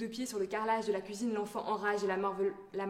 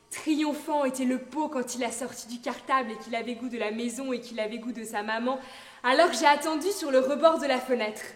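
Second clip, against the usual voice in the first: loud, mounting outburst; impassioned climax; 4 semitones higher, 10 dB louder than usual. Acted. Mother insisting on her proud hopes for the snack, impassioned and loud. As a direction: swell to a loud, higher peak.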